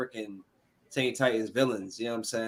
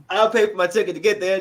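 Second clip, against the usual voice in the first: normal talking voice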